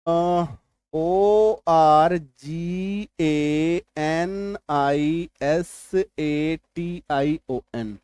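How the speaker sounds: letters of organisation drawn out very slowly; slow spelling. Shown as a demonstration. Teacher spelling organisation for the class, very slowly. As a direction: spell each letter slowly as you write. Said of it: "जैसे कि ये देखिए".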